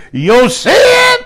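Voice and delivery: high, loud preacher's cry on 'Your sin'; elder preaching. Retold or imitated. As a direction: call it out like an old-time preacher. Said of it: "he preached".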